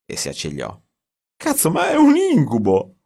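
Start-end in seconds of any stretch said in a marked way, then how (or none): 1.4-3.0 loud, high cry of disbelief; panicked outburst. A narrator's reading. Writer cracking at the editors' plan, loud and high. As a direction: explode in horror at a nightmare.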